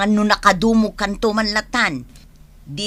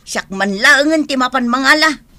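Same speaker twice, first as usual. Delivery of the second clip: normal talking voice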